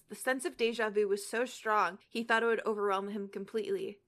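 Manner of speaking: plain reading voice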